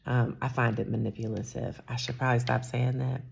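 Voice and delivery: ordinary talk, nothing marked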